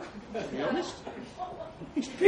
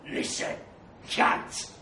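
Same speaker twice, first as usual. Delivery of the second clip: gravelly; slower than usual. A narrator's reading. Trev warning the narrator off, gravelly. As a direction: voice like gravel, menacing. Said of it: "his voice like gravel".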